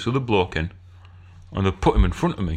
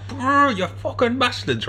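inner grumble; 13 semitones higher, slower than usual. Retold or imitated. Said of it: "I'm there, I'm".

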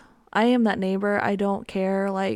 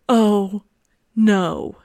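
much louder, falling oh no; dismayed oh no; 8 dB louder than usual. Retold or imitated. She finds the kitchen flooded, a loud dismayed cry. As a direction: let it drop in dismay.

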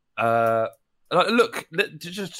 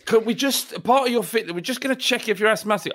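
high-pitched official voice; club official voice; 10 semitones higher than usual. Acted.

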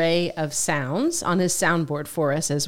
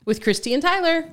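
higher, bright-and-peppy morning radio pitch; morning show host; 7 semitones higher than usual. Acted. Co-host pitches a new podcast name, morning show voice. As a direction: sell the show title like a morning DJ. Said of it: "like it's a, a real morning show".

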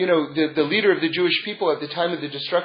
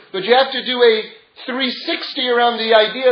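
usual speaking voice

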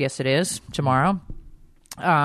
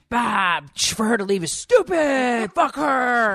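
mock indignant shout; 6 semitones higher, slower than usual. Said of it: "Everybody loves to, like, chime in".

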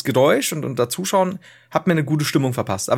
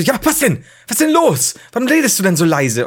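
irritated; 6 semitones higher, 4 dB louder than usual. Retold or imitated. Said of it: "Ich so".

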